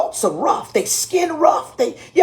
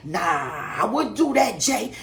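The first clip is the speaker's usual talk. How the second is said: low, soft mock bishop voice; the bishop shaking his head, lightly voiced; Bishop Page voice; 5 semitones lower, 5 dB quieter, slower than usual. Bishop warning the pastor off, soft and lightly lower. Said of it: "if you ever heard Bishop Page talk".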